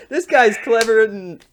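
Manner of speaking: high, loud, slow hyped-up praise; loud high mock hype. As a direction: cheer him on, over the top.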